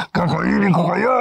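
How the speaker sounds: hammed-up radio horror creature; monster. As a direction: menace them like a hungry monster. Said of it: "may biglang monster na dumating".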